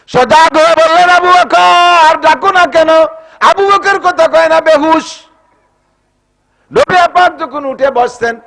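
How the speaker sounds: high, loud dramatic outcry; fervent storyteller's cry at sermon pitch; full-force preaching. A narrator's reading. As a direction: call out with long-held vowels, as if to wake someone. Prophet calls to unconscious companion.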